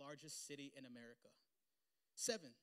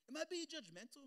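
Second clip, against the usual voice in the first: much higher, incredulous; mock incredulous question to his wife; indignant question; 12 semitones higher, 5 dB louder than usual. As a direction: ask it as if it can't be true.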